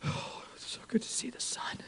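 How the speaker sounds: airy whisper of a sunburned tourist; mocking sweaty Chicago tourists. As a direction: breathe it out, wilting in the heat. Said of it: "people from Chicago that are like".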